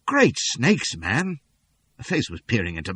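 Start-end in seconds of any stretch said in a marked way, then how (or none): 0.1-1.4 surprised. A narrator's reading. Startled townsman.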